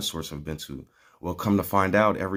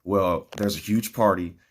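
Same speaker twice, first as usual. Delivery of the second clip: normal talking voice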